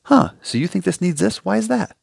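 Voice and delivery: a patient calmly challenging, politely curious; curious questioning. Pretend. Patient quizzing the dentist in the chair, curious and probing. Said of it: "in a informative, intellectual sort of way".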